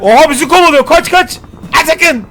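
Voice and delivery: panicked yelling, pitch up; being chased. Real, not acted. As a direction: yell in alarm while fleeing. Chased by robot dog in game. (kovalanırken panik içinde bağırma)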